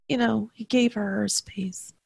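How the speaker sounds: soft confiding whisper. Real, not acted. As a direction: drop to a breathy aside. Confiding aside about dad.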